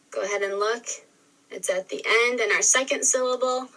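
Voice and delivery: normal talking voice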